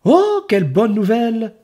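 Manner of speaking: high, mock-delighted boy; exclaiming. Enzo mocking the stricken ogre, high and delighted. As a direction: cry out with fake joy. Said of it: "s'écrie Enzo".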